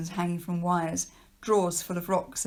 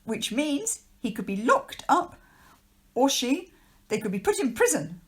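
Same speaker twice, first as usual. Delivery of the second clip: squeaky voice; 6 semitones higher, 4 dB louder than usual. Acted. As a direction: squeak out the threat nervously. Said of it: "squeaked Mister Lowe".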